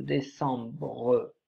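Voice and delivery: décembre said slowly, each syllable drawn out; teacher dictating décembre at a crawl; slowly stretched. Shown as a demonstration. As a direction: draw out décembre so pupils can write it. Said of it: "word said slowly after spelling".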